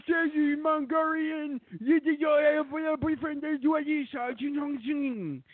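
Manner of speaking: exaggerated accent, high and slow; mock Vietnamese accent.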